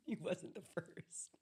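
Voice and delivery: slow, voiceless words through laughter; laughing. Real, not acted. Host conceding the co-host's joke, helpless laughter. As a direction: squeeze it out while giggling.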